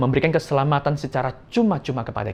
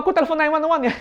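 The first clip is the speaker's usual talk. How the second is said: mock panicked excuse; 14 semitones higher, faster than usual. Acted cowardly excuse to mother.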